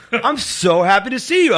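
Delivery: high, drawn-out imitation of delight; overjoyed. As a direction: gush it, mimicking a thrilled friend. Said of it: "he said it like".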